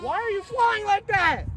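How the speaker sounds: high, loud wail of protest; exasperated shout. Acted. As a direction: scold the plane at the top of your range. Yells at misbehaving plane.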